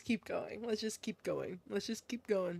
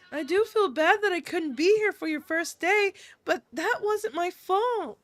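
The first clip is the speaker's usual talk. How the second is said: put-on higher, protesting girlish voice; defensive girl character; 5 semitones higher than usual. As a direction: defend yourself to a friend in a higher voice. Same character defends herself.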